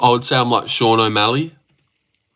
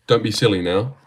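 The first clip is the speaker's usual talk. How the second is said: ordinary talk, nothing marked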